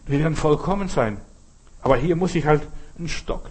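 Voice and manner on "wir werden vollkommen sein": usual speaking voice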